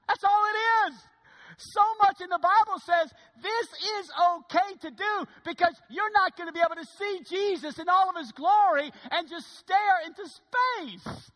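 loud, high, full-force preaching; fiery sermon climax; preaching shout. Real, not acted. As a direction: shout it to the back pews.

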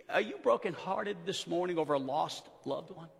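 soft, low, breaking with emotion; tearful hush. Acted. Preacher asking grieving congregation, tearful and soft. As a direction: ask gently as if holding back tears.

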